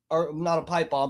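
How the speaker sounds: usual speaking voice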